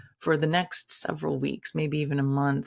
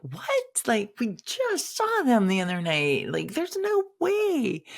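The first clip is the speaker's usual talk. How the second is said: rising high and slow on no way; can't believe what she's hearing, voice climbing; a mock stunned cry of disbelief; 6 semitones higher than usual. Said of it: "I was like".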